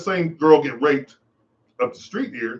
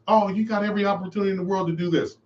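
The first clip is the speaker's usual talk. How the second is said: a high, quick, dismissive put-on voice; 6 semitones higher, faster than usual. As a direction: sneer it out, quick and high, like someone brushing you off. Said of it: "who says".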